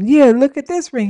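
high, eager mimicry; retold excitement. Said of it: "all of a sudden he gets excited".

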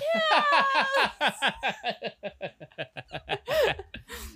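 long high-pitched laughter. Real, not acted. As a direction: cackle up high. Reaction to Betty impression.

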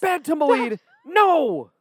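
high, loud scolding of a horse; mock dismayed yell. Co-host acting the losing bettor at the track, dismayed yell. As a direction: yell in hammed-up despair as the bet slips away.

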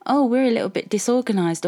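scornful mimicry of people joking about ADHD; mocking quote. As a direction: parrot it back as airy and dismissive. Mocking the TikTok ADHD joke.

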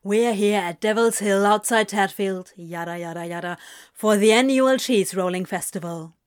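weary reporter reciting yearly intro; bored presenter. A narrator's reading. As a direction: rattle it off uninterested. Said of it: "same speech he gave every year".

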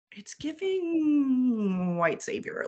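slow, high, drawn-out verdict; hesitant judgement. Real, not acted. Reader rethinking a book from high school, hesitant judgement. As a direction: stretch it out as a delicate accusation.

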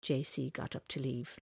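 plain reading voice

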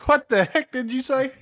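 "What" said with a breathy H before the W, high-pitched; pronounced H in "What". Acted. Mocking the newcomers' speech, "What" begun as "Hwat". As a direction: puff out the H at the start of "What". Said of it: "you gotta pronounce the H".

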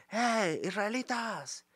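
high, drawn-out mock call from afar; imagined shout. David waking the sleeping Israelite camp, high mock call. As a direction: hail the sleeping camp across the hills. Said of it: "lo que gritó fue".

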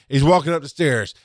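ordinary talk, nothing marked

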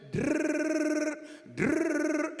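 a high trilled drrrr; rolled drrrr. Shown as a demonstration. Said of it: "I want you to say".

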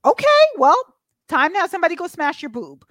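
high chirpy doctor voice; lightly voiced cheerful doctor; breezy doctor. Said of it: "she was like".

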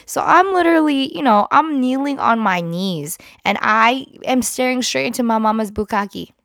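normal talking voice

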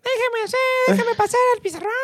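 very high, thin falsetto schoolboy; hammed-up high-pitched pleading student; squeaky fake voice. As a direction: squeal it up high like a kid begging the teacher. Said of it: "se va a hacer así como de".

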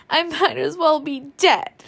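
high, despairing sob; a girl weeping into her pillow; passionate sobbing. Acted. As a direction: throw the line away in tears. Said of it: "she sobbed passionately".

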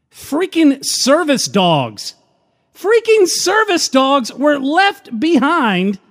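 slow, high-pitched incredulous outrage; drawn-out, exasperated emphasis; indignant emphasis. Real, not acted. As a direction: drag out each word in disbelief. Incredulous outrage at dogs left behind.

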